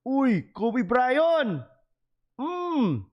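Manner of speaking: put-on Filipino accent, high and very slow; Filipino-accented Kobe Bryant. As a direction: drawl the name like a Filipino uncle. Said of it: "That's exactly how they say Kobe Bryant's name".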